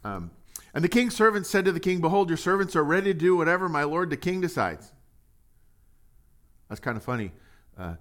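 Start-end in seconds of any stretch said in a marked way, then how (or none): 0.7-4.9 raised, fast, projected Bible reading; scripture reading. Acted. Preacher to church, reading scripture boldly. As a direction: read the verse out loud to the congregation.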